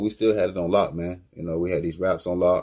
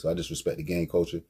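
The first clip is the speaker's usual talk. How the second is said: usual speaking voice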